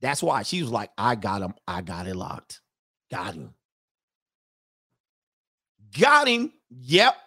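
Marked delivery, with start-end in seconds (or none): 1.0-3.5 low, soft imitation of a satisfied wife; hushed mock gloating. Host imitating a wife who locked her man in, hushed gloating. 6.0-7.1 high, punchy imitation of a triumphant wife; mock triumph. Host imitating a wife who landed her man, mock triumph. Same wife's line, triumphant.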